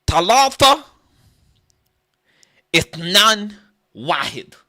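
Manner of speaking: high loud shouted count; mock Arabic counting. Comic counting in Arabic, loud high calls. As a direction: call out the numbers high and loud, falling.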